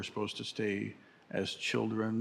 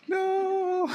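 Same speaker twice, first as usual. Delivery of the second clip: much higher, loud shocked no; horrified student; 7 dB louder than usual. Pretend. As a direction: cry out in mock horror. Student refuses in horror.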